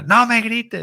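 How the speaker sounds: high, loud mock yell of a parent; a parent yelling no me grites at a yelling kid. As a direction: shout back at the child, hammed up. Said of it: "El niño grita".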